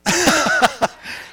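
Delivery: big real laugh at Gary; loud laugh. Real, not acted. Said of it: "Sorry, Gary".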